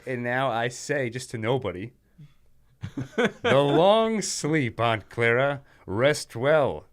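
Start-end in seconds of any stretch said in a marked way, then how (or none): 3.1-6.9 slow, higher hammy villain gloat; evil villain voice. Acted. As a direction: savor every word like a cartoon villain. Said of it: "in the evil villain voice like that".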